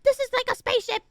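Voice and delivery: high, fast mock-amazed mom; mom baffled by tech. Mom amazed at the new car's touch screen, high and quick. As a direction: gush like a baffled mother. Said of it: "she was just like".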